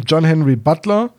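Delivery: usual speaking voice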